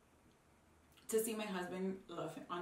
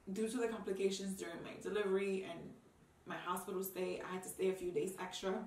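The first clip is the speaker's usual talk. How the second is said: usual speaking voice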